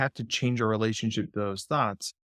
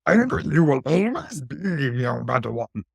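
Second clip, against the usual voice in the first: Yoda; 3 semitones higher than usual. Acted. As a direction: put on a passable impression of the little sage. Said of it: "you could do Yoda".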